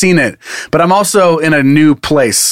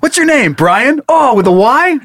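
much higher, eager friendly voice; mock chatty stranger; 9 semitones higher, slower than usual. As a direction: overdo the nosy stranger. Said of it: "imitating mail guy chatter".

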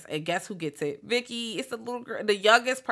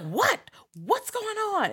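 much higher, bewildered; startled question; slower than usual. Retold or imitated. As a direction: jolt awake to the chaos. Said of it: "he's like".

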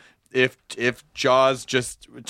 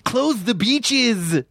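slow, high dog-actor voice; dog playing Dreyfuss; 9 semitones higher, much slower than usual. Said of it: "That's Dreyfuss, the dog from Empty Nest".

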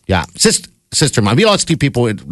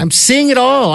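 suddenly much higher, worked up; excited. Real, not acted. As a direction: pitch jumps up in exasperation. Ranting about the Browns.

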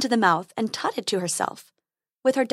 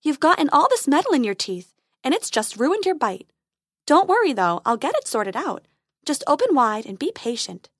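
fairy voice; 4 semitones higher, 4 dB louder than usual. Acted.

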